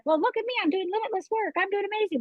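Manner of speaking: high, airy self-assured voice; defensive past self, high and breezy; mock defensive boast. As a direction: brush off the worry with bright bravado. Voicing her defensive past self.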